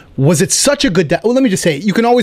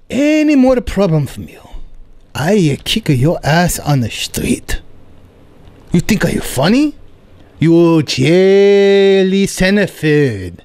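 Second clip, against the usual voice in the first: put-on threatening Korean accent, very slow at the end; Korean landlord accent; slower than usual. Landlord threatens tenant over the plumbing, Korean accent. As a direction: menace him in a Korean accent. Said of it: "He goes".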